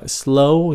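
"Slow" pitched high and rising; stressed slow. Shown as a demonstration. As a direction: lift "Slow" up with emphasis. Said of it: "nhấn âm nó".

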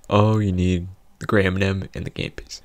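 usual speaking voice

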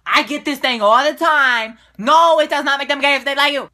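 loud, heated rant about a question she always gets; angry rant. Real, not acted. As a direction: snap it out, fed up and loud. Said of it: "I'm just so full of anger right now".

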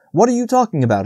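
high-pitched indignant question; lightly acted indignation; scolding. A narrator's reading. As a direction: scold them, voice pitched right up. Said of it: "rushed up and scolded him".

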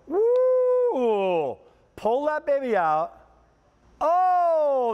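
high, excited whoop. Real, not acted. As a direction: cheer the product reveal. Cabinet caddy revealed on air.

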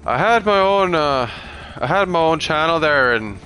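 imitating an Irish YouTuber; Irish accent. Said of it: "I just wanted a reason to do an Irish accent".

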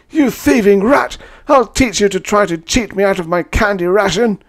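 high, loud raving insults; raging abuse. A narrator's reading. Chowderhead screams insults at his rival, raving. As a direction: hurl the insults at him. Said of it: "He called me a million names".

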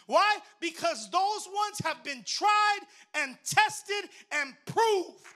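loud, rising preacher's cry; fiery pulpit delivery; preaching at full force. Acted. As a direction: drive it home to the congregation. Preaching build-up.

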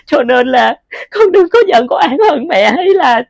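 mother sobbing an apology to her lost daughter; crying apology. Acted. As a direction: cry it out as you apologise. Mother crying, asking forgiveness.

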